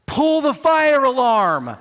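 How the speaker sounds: high, loud panicky yell; panicked shout. Retold or imitated. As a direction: blurt it out in retold panic, slow. Retold panic at the mall.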